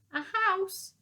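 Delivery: disbelieving yelp; shocked. Acted. Shocked old soldier.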